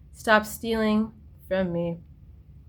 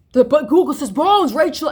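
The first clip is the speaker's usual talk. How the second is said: mock know-it-all friend; 6 semitones higher, 7 dB louder than usual. Mimicking friends arguing with her.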